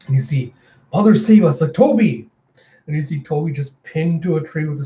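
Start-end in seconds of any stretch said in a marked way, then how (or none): 0.9-1.6 mild panicked cry for help, higher and louder; alarmed cry. Acted. Vampire cries out in alarm. 1.7-2.3 high alarmed shout of a name; alarmed call. Acted. Calling out to pinned comrade.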